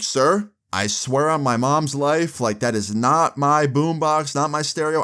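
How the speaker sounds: acted-out insistent denial; earnest pleading. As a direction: plead your innocence word by word. Retold earnest denial to the dad.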